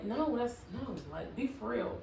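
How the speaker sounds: ordinary talk, nothing marked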